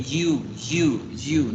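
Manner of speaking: "you" with a firm consonant start, like ju; hard Y of yuca. Shown as a demonstration. Teacher reads Jessica's answer, "you" with a consonant Y. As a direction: begin "you" on a Spanish yuca Y. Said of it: "suena con Y de yuca".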